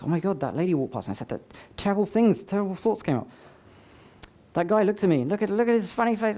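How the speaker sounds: imitating a judgmental voice in the head; judgmental inner voice. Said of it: "all those judgments".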